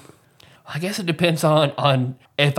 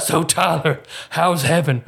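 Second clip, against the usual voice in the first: lightly voiced Dave; slower than usual. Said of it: "It's me doing both of our voices".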